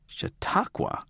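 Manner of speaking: unvoiced puzzled whisper; thought aloud. A narrator's reading. Narrator puzzling to himself, soft whispered question. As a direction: whisper it in disbelief. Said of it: "I thought".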